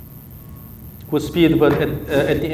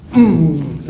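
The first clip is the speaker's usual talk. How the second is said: loud falling "mmm" for the swing; effort hum; 5 dB louder than usual. Shown as a demonstration. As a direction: hum the push of the swing loudly.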